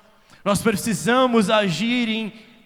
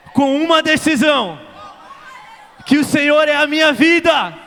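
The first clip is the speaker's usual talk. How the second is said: higher, louder, slow crying out; fervent cried prayer; 5 semitones higher, 7 dB louder, slower than usual. Acted. Preacher leading congregation, crying out loudly in prayer. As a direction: cry out to Jesus with everything.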